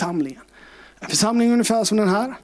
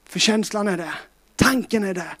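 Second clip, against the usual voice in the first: ordinary delivery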